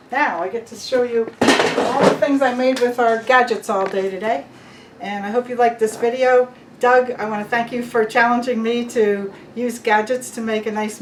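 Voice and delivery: upbeat, loud sign-off to camera; bright presenter wrap-up; vlog host. Acted.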